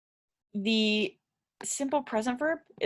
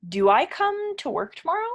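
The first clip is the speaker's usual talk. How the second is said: higher, louder question rising on "tomorrow"; question rise; 8 semitones higher, 4 dB louder than usual. Shown as a demonstration. Teacher demonstrating the question form to learners, rising up. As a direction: ask it brightly, lifting "tomorrow" at the end. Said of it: "And you make it into a question".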